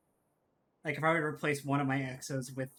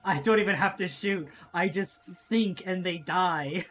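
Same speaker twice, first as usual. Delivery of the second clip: megalomaniac rant; 4 semitones higher, 6 dB louder than usual. Pretend. Mock villain gloating.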